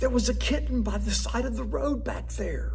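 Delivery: put-on high pleading kid voice from the back seat; child's voice. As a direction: beg like a little kid who spotted a kitten. Kids begging father in car.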